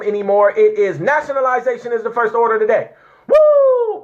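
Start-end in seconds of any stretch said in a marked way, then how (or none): 3.3-4.0 high, loud "woo" held long; excited whoop. Real, not acted. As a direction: let out a high, ringing whoop. Elated outburst over the temple's purpose.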